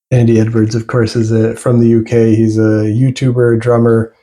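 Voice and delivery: ordinary talk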